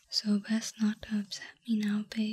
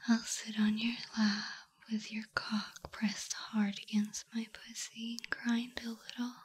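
normal talking voice

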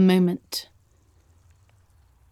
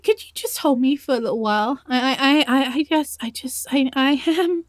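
usual speaking voice

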